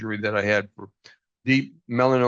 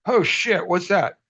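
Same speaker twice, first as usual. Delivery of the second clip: surprised; 7 semitones higher, slower than usual. Retold or imitated.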